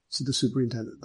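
usual speaking voice

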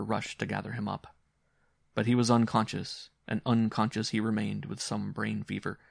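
usual speaking voice

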